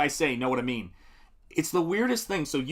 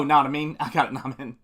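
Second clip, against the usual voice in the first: ordinary talk, nothing marked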